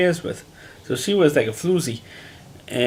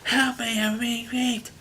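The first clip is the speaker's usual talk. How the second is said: high, strained mock cry for help; hammed-up scream for help; 9 semitones higher, 5 dB quieter, slower than usual. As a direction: shriek it out like a panicked victim. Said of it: "instead of screaming it out".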